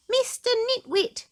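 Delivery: very high jeering sing-song; mock him with relish; taunting name-call. Mister Small to Mister Bump, a very high jeer. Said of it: "he said".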